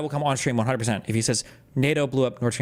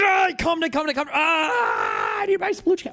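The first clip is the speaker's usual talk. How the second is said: very high frantic mock scream; hammed-up shouting; 13 semitones higher than usual. Streamer overreacting to the lecture, hammed-up shouting. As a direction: shriek it as if overcome. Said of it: "'cause I shouted".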